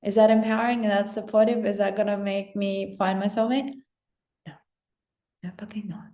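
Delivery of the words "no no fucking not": harsh whispered denial. Acted. Coach whispering a blunt no to viewers. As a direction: hiss the no under your breath. Mocking her own negative self-talk.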